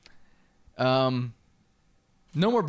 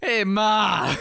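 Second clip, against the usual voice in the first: hillbilly calling out; 7 semitones higher, 5 dB louder than usual. Pretend. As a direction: yell for Ma like a backwoods kid. Hillbilly joke, calling mother.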